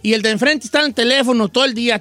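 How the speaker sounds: ordinary talk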